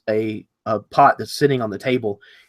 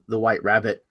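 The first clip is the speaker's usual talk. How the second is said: ordinary talk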